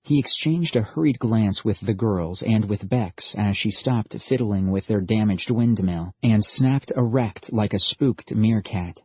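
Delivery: plain reading voice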